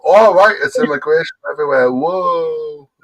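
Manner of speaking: loud, high mock amazement; sarcastic. Real, not acted. Mocking the other's obvious news.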